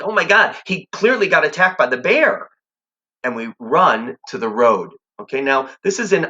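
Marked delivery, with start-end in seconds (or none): 0.0-2.5 retold kid panic. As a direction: relive the terror, pitch flying up. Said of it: "because we're like".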